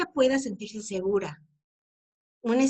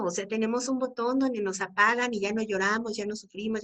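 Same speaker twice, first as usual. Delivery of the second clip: usual speaking voice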